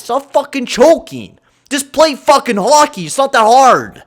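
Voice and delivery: high and loud scolding; angry shout. Real, not acted. Gamer yelling at his players to stop choking, angry. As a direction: bark orders at the team.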